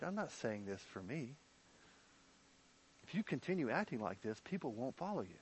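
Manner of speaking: a hint of quiet, worried pleading; Michal's soft concern. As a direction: voice Michal gently fretting over David. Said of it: "the kind of things Michal says".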